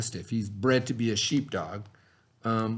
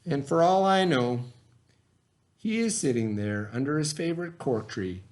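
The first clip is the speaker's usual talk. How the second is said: put-on reading a story aloud to children; lively read-aloud for young listeners; expressive picture-book narration; storybook reading. A narrator's reading.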